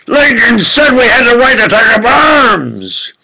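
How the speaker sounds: ordinary delivery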